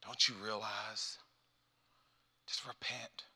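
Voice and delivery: very soft, gentle urging; hushed, kindly plea; thinking like Jesus. Acted. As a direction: say it tenderly, nearly under your breath. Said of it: "we start thinking like Jesus does".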